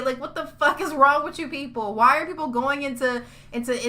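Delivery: heated, incredulous tirade; mad rant. Real, not acted. Fan scolding Telltale over unfinished episodes, mad rant. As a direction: fire off outraged questions at the company. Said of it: "I'm so goddamn mad".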